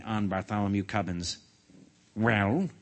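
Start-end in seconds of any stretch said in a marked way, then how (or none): 2.1-2.7 restrained, expectant demand with a rise; demanding question. A narrator's reading. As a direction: ask it pointedly, holding back. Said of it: "he demanded".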